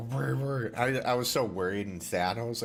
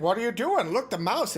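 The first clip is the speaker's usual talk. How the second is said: high, louder excited cat voice; voicing the cat; 13 semitones higher, 5 dB louder than usual. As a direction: play the cat, eager and pushy. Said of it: "and he's like".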